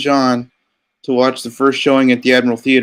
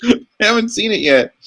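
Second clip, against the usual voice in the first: mock dad; 10 semitones higher than usual. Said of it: "My dad's like".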